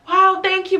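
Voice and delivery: lightly voiced over-sweet client; mock gushing thanks. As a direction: play up a sugary thank-you. Client thanking her for ride.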